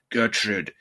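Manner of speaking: deep, slow, solemn summons; ominous angel voice. Acted. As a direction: intone it low like an otherworldly preacher. Voices angels speaking to Gertrude.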